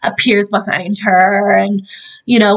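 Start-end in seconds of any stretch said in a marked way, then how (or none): none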